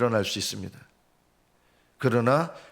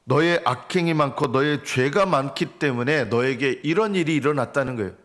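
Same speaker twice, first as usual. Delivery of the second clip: ordinary talk, nothing marked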